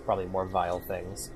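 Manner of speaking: steady, low-key audiobook reading; calm first-person story reading; story narration. A narrator's reading. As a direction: read the tale evenly to the listener. Reads fantasy story aloud.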